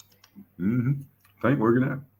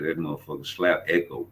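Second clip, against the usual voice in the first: usual speaking voice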